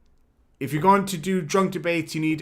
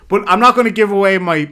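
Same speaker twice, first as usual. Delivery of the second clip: hyped; 7 semitones higher, 9 dB louder, faster than usual. Real, not acted. Hyping his debate stance.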